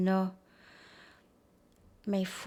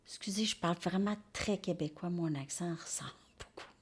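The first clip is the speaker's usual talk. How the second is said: quick, hushed apology; whispered aside; 4 semitones lower, 9 dB quieter, faster than usual. Real, not acted. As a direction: drop to a fast whisper to excuse yourself. Hushed apologetic aside about her accent.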